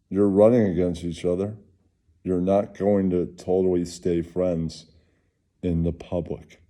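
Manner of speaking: ordinary delivery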